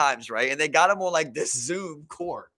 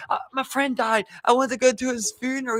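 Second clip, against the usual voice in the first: mock kid excuse; 7 semitones higher than usual. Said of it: "He's like".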